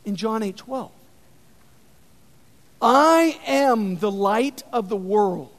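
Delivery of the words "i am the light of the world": slow, measured first declaration; preacher reading Jesus' words slowly; slow proclamation. Acted. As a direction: lay it down slowly, weighing each word. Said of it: "he proclaims".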